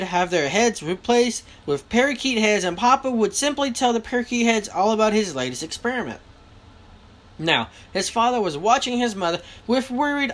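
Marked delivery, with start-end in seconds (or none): none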